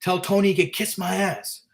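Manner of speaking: lightly voiced defiant tough guy, rising high and fast; a hint of a cocky debtor getting heated; defiant refusal. Debtor refusing to pay the collector, lightly acted defiance. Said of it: "this guy's like".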